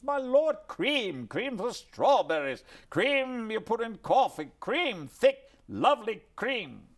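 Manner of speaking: high, loud, relishing every word; Chaplin raving. Retold or imitated. Chaplin explaining to his guest what cream is, high and loud. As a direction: savour cream like Chaplin adores it.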